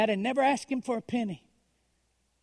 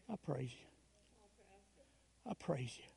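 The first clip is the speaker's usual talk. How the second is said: much lower and much softer, nearly gone; hushed whispered praise; 6 semitones lower, 20 dB quieter than usual. Retold or imitated. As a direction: let the words barely leave your lips. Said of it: "I just let it kind of whisper".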